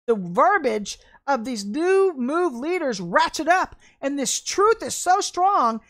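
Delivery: usual speaking voice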